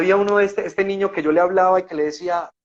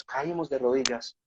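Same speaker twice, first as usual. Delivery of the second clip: softer and slower, a moved murmur; hushed, moved repeat; 3 semitones lower, 8 dB quieter than usual. Retold or imitated. Recalling kneeling by Samuel's bed, hushed and moved. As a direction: let it sink into a hushed echo. Falling to knees at Samuel's bedside.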